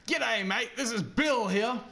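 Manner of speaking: big, broad Australian bloke greeting; Bill, Australian accent. Retold or imitated. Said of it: "deepest, most intimidating Australian accent".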